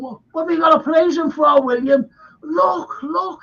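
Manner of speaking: high, fast, urgent "look, look"; excited pointing out. Retold or imitated. Pilgrims urging Bradford to look, high excited calling. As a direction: grab their sleeves and point. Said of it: "I don't think he had a Scouse accent".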